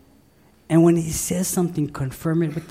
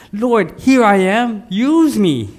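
loud, raised-pitch declaration; bold call; 6 semitones higher, 7 dB louder, slower than usual. Pretend. Preacher modelling a volunteer's prayer to the Lord, bold loud call. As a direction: call it out fervently to God.

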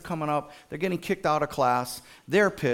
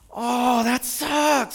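high, loud mock wail of sympathy; hammed-up commiseration; 10 semitones higher, 4 dB louder, much slower than usual.

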